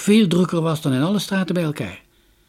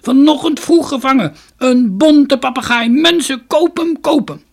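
market crier; 13 semitones higher, 10 dB louder than usual. A narrator's reading. Merchant urges passers-by to buy the parrot, crying out loud. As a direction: sell it to the whole crowd. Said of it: "schreeuwde de koopman".

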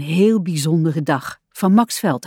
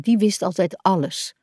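plain reading voice

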